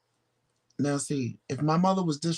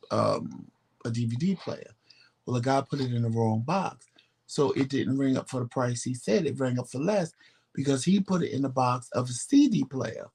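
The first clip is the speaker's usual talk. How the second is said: ordinary talk, nothing marked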